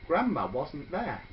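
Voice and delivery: usual speaking voice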